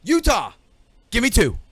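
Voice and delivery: calling out window. Retold or imitated. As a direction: shout the order out the window. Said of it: "he'd poke his head out the window".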